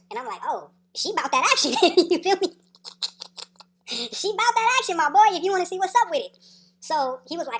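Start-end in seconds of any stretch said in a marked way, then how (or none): 0.5-2.5 louder, laughing as it lands; excited retold quote with a laugh; hyped reply. Hyped retold reply to his friend. 3.9-6.4 loud, high, gleeful retold quote; hyped-up reply. Repeats hyped reply to his friend.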